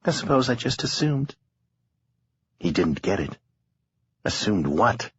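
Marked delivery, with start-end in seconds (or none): 0.0-1.4 lightly voiced young woman, higher; a hint of a tired young woman. A narrator's reading. Harlow voiced in narration.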